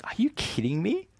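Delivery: voicing the listener's amazed protest; incredulous disbelief. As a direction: play the stunned skeptic who can't believe it. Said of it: "And we think".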